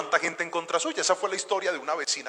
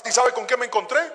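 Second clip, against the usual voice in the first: normal talking voice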